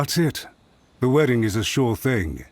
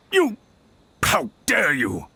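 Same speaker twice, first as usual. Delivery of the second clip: slow, indignant outburst; outraged; 9 semitones higher than usual. Acted. Official exposed by the commissioner, outraged and slow. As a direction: spit the name out in shocked outrage.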